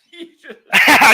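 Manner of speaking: high, loud laughter; laughing. Real, not acted. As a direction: laugh out the words. Both laugh at the story.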